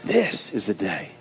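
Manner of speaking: "This" hit first and firm; stress on This. Shown as a demonstration. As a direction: lean on "This" in "This is the day". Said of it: "You put that accent on any word".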